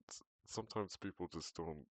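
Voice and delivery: ordinary talk, nothing marked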